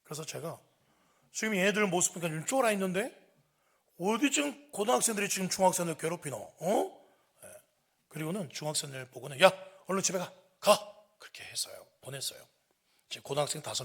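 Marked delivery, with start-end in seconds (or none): 1.3-7.1 loud, high-pitched scolding; indignant grown-up telling teens off; stern confrontation. Retold or imitated. Confronting bullies in retold scene. 9.4-11.0 loud, high command; forceful shooing shout; urgent order. Retold or imitated. Sending younger kids home.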